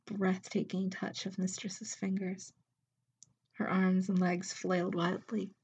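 usual speaking voice

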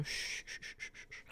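soft fading shh sounds; soothing shushing. Acted. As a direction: hush him gently. Soothing the whimpering listener.

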